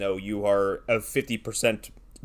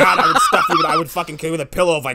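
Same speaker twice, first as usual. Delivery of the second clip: laughing; 6 semitones higher, 6 dB louder, much faster than usual. Real, not acted. Laughing through mock dad rant.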